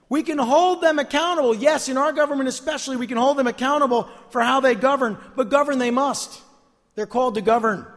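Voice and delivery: loud, high-pitched pulpit insistence; emphatic preacher in full voice; full-force preaching. Real, not acted. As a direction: drive each point home to the congregation.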